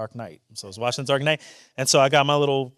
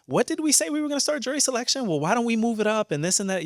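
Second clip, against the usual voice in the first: busy lawyer; 11 semitones higher than usual. Retold or imitated. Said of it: "conference with the lawyers on my trial and say".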